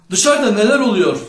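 high, loud questioning call. A narrator's reading. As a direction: call out to people outside. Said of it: "diye seslendi".

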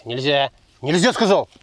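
sharp loud forbidding; stern command. Real, not acted. Строгий запрет. (строгий громкий запрет)

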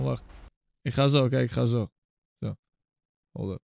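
usual speaking voice

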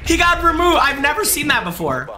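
high-pitched amazed outburst; amazed excitement. Real, not acted. Streamer reacting to an enemy removed, amazed excitement. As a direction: exclaim high, reeling from the shock.

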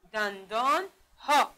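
دندان ها said slowly with a gap before ها; slow teacher's break-up of دندان ها; syllable split. Shown as a demonstration. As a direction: draw out دندان, then set ها apart. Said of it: "چند بخش شد؟".

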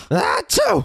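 high-pitched fake achoo; mock sneeze. Robot sneezes at friends by accident, high fake sneeze. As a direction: burst out a sudden pretend sneeze. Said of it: "Dude, stop sneezing".

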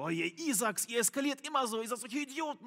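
high, put-on voice of a fed-up drunk friend; mimicking the friend's high whiny scolding; exasperated friend. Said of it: "der Freund, der so".

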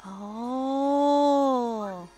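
ordinary talk

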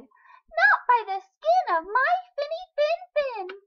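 very high, loud little fish; brave little fish. A narrator's reading. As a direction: squeal it back bravely. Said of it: "the brave little fish replied".

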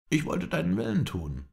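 high-pitched, pleading excuse; apologetic. A narrator's reading. Mohammed excuses himself for freeing the captive, apologetic. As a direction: excuse yourself, voice lifted high. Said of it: "entschuldigte er sich".

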